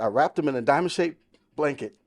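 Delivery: usual speaking voice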